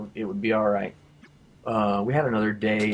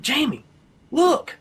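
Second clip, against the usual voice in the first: high, excited calling out; 3 dB louder, slower than usual. Retold or imitated. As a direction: call his name, thrilled and urgent. Friend calls out excitedly, healed.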